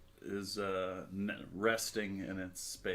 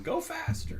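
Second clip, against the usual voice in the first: put-on much higher, squeaky kid voice demanding speed; mock child yell from the passenger seat; imitating daughter. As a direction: play the little girl begging to go faster. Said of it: "She's always yelling".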